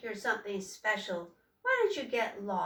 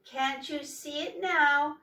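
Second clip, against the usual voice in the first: louder, slow and mocking; taunting; 6 semitones higher, 7 dB louder than usual. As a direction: draw it out to mock him. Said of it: "Bilius Bargy taunted".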